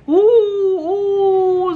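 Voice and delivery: high, long "ooh" wail; imitated crowd scream. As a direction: mimic people screaming. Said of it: "Orang-orang pada teriak-teriak". (menirukan jeritan orang-orang)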